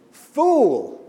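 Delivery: a loud, high rebuke; God rebuking the rich man, loud; rebuking shout. Acted. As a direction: fling the word at him.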